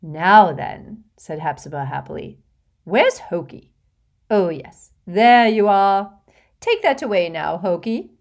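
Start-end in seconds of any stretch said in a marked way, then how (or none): 0.0-1.0 put-on fussy, gushing rich old lady, laughing in; a vain old witch showing off to a charming boy; giggly hostess voice. Hepzibah bustling. 2.8-8.1 put-on fussy, gushing rich old lady, laughing in; a vain old witch showing off to a charming boy; giggly hostess voice. Hepzibah calling the elf.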